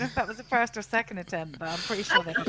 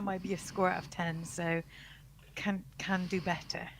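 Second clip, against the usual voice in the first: whispering; 2 semitones lower, 8 dB quieter than usual. Real, not acted. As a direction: whisper it under your breath. Aside after low score.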